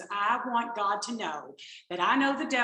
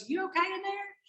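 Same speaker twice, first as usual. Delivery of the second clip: concerned husband; 10 semitones higher than usual. Retold or imitated. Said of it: "My husband goes".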